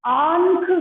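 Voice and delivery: आंख with the aa sent through the nose; teacher models आंख slowly; nasal vowel demo. Shown as a demonstration. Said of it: "इसको क्या बोलोगे?".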